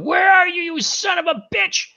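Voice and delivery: enraged high-pitched hollering; furious cartoon yell, high and strained; Quagmire. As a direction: call out high in a rage. Quagmire hunting Brian in rage.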